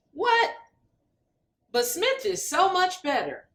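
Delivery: high, indignant what; a supporter hears someone chose Brown; shocked outrage. Acted. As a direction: squawk in disbelief. Outraged at pollster answer.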